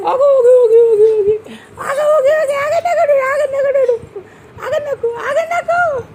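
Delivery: very high-pitched repeated hushing; high singsong comforting. Real, not acted. Soothing a crying child.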